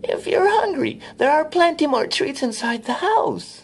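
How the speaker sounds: put-on high, sweet old woman voice; sugary and inviting, very high; kindly old woman. A narrator's reading. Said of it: "she said kindly".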